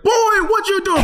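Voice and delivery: high, loud scolding mother voice; scolding mom. Retold or imitated. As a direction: catch him in the act. Mom catching son.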